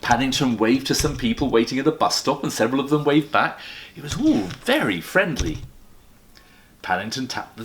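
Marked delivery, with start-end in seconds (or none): none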